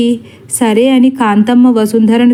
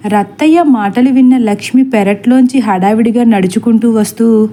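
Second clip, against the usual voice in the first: ordinary delivery, nothing marked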